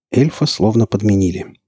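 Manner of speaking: usual speaking voice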